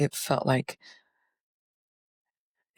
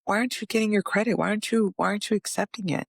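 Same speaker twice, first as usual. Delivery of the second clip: higher, earnest urging voice; voice of the spirit guide; 6 semitones higher than usual. Retold or imitated. Spirit guide urging the speaker to accept credit, higher and earnest. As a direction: press them to take the credit.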